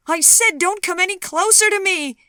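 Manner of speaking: very high, loud, panicked shout; panic-stricken cry. Acted. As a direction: scream it in pure panic. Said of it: "she cried, panic-stricken".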